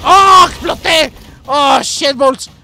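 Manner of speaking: loud, slow, drawn-out dismay; shocked shout. Real, not acted. Gamer shouting after blowing himself up. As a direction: cry out as the explosion hits. Blown up in game.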